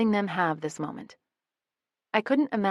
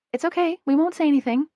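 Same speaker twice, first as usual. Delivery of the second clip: promising; 10 semitones higher, 6 dB louder, faster than usual. A narrator's reading. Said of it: "Jamie promises".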